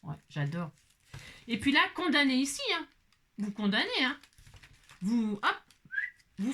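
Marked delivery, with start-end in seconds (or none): none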